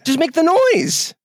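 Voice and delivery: exasperated, pitch shooting up; excited high rant. Real, not acted. Ranting about animated wind.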